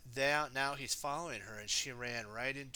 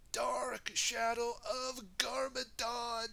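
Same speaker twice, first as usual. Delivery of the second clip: high, slow, drawn-out spooky voice; spooky reveal; 11 semitones higher, much slower than usual. Acted. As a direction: wail it out slowly, hammed-up dread. Scary reveal of the villain.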